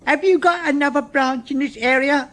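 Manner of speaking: put-on high, ingratiating old uncle; butter him up; wheedling. A narrator's reading. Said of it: "Uncle Roger wheedled ingratiatingly".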